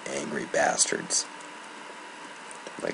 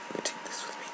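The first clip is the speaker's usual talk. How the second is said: whispered to himself; real whispered aside; 11 dB quieter, much faster than usual. Real, not acted. Muttering aside while playing.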